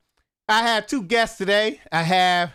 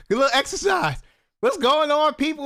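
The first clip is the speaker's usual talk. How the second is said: ordinary talk, nothing marked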